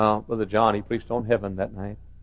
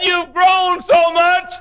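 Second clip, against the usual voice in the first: high, loud, slow exclamation; delighted grandma; 10 dB louder, much slower than usual. Retold or imitated. Grandma greeting grandchildren at the airport, slow delighted exclamation. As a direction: gush over how big they are.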